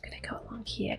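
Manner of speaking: usual speaking voice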